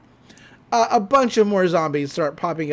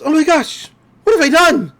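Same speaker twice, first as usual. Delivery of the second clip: high, loud horrified cry; panicked; 8 semitones higher, 7 dB louder than usual. Acted. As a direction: gasp it out in sudden dread. Deborah realises her mistake.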